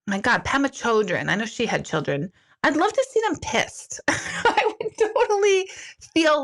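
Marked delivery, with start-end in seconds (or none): 2.6-6.4 gleeful high. Real, not acted. Wants to see gurus pissed.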